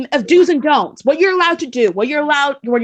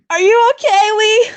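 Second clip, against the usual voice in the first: calling out; 6 semitones higher, 3 dB louder than usual. Real, not acted. Calling out to co-host Lee.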